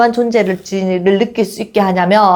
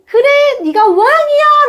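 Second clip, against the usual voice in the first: much higher, louder enthusiastic agreement; cheering shout back; 4 dB louder than usual. Shown as a demonstration. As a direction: cheer your child's claim with gusto. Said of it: "라고 외쳐줄 수 있어야". (아이 외침에 신나게 맞장구치는 외침, 훨씬 높고 크게)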